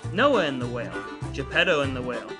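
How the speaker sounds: ordinary talk, nothing marked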